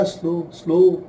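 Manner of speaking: each slow stretched and unhurried; slow drawn out. Shown as a demonstration. Teacher demonstrating a slow pace. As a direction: say slow stretched, at half speed. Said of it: "आप slow होंगे".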